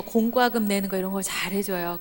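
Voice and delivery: ordinary talk, nothing marked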